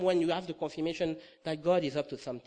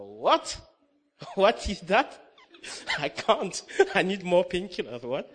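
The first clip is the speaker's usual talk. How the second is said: mock disbelief, pitched up and laughing; retold comic incredulity; incredulous protest; 4 semitones higher, 6 dB louder, slower than usual. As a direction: protest loudly through laughs. Retold protest at God's request.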